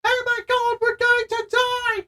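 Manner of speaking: very high, over-dramatized mock panic; high-pitched skeleton voice. Playing a walking skeleton doomed in battle, high-pitched. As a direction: squeal it like a doomed cartoon skeleton. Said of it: "it's always a high-pitched voice".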